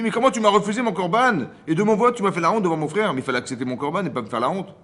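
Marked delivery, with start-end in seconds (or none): 0.0-3.1 much higher and louder, a wronged brother protesting; indignant outcry. Retold or imitated. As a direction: shout out the injustice of the refused offering. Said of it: "Tu dis".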